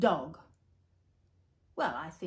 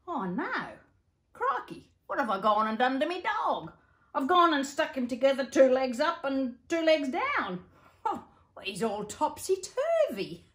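put-on Old Joe dismayed; 5 semitones higher, 4 dB louder than usual. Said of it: "this is what he did".

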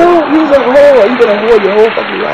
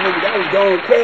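normal talking voice

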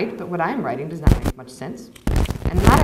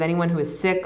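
ordinary delivery, nothing marked

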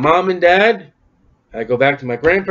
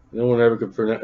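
ordinary talk, nothing marked

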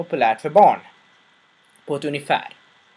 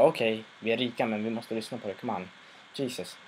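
much softer, grumbling bored aside; bored rich guest; 4 semitones lower, 10 dB quieter than usual. Retold or imitated. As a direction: mutter it as if this is beneath you. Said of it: "så jag tänker om en av de rika".

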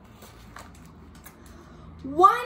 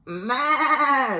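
drawn-out maa; long playful goat noise; goat bleat; 3 semitones higher than usual. Acted. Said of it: "said Goat".

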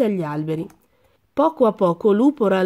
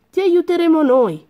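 eager promise; 9 semitones higher, 5 dB louder than usual. A narrator's reading. Friend reassures Lupo.

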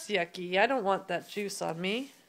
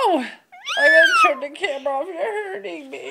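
loud, fast, laughing fake distress; laugh-cry as if crushed; mock panic; 9 semitones higher, 13 dB louder than usual.

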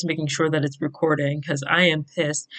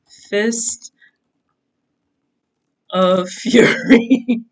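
laughing; 4 semitones higher, 5 dB louder, much slower than usual. Real, not acted. Laughing reading title.

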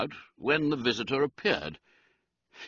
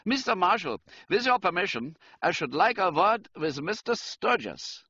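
higher-pitched German count; German-accented aristocrat, pitched up; 10 semitones higher than usual. A narrator's reading. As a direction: address him formally in a German accent. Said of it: "He spoke with a German accent".

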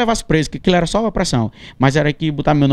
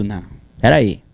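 usual speaking voice

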